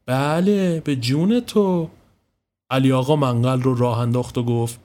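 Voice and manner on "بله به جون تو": high, drawn-out smug comeback; not backing down. A narrator's reading. Woman tops her rival's surprise, refusing to back down. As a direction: stretch it out, refusing to be outdone. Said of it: "که کم نمی آورد گفت".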